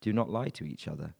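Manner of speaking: usual speaking voice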